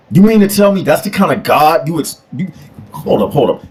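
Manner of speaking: normal talking voice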